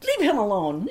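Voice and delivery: loud, laughing self-rebuke; mock-stern outburst with a laugh; shoulder-angel scolding. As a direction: cut in loud, ordering yourself off. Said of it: "angel and devil on my shoulder".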